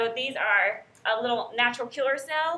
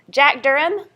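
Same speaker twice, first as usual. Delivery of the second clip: calling roll; 2 semitones higher, 8 dB louder than usual. Real, not acted.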